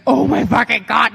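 loud, stunned outburst; overwhelmed excitement. Retold or imitated. Rookie told she will be signed, loud and overwhelmed. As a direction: blurt it out, losing it. Retold reaction to being signed.

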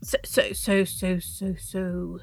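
usual speaking voice

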